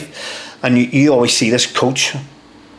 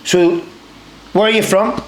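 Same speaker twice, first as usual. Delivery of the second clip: higher-pitched imitation Scottish corporal; Scottish accent; 5 semitones higher than usual. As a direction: question the recruit in put-on Scottish. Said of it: "he goes in a Scottish accent".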